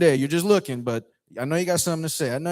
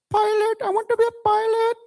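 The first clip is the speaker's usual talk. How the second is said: very high squeaky meme voice; piping, high-pitched impression; put-on accent voice; 4 dB louder than usual.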